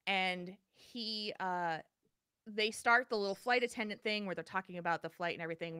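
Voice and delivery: ordinary delivery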